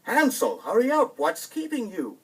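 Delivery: high, loud call into the distance; father calling out. Acted. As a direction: call back down the path, impatient. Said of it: "the father called back".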